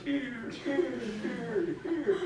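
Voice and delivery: imitated echo dying away. An empty land answering the caller, echo. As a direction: repeat it as a fading echo. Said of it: "That echoes".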